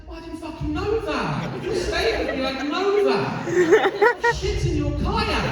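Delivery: high-pitched, exasperated retort; acted-out exasperated rant; pissed off. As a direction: argue back in a high, indignant voice. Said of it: "I was pissed".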